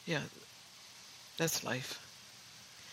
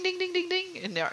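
high sung ding ding like a country guitar; guitar twang imitation; 14 semitones higher than usual. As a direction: mimic a twangy guitar string, pitched way up. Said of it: "guitar ding".

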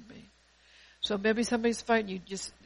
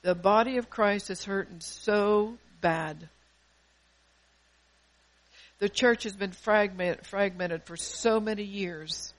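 louder, slow, pleading sermon voice; preaching at full force; 5 dB louder than usual. Acted. Pastor pleads for love in the hurting church, loud and slow. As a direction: cry it out to the congregation, drawn out.

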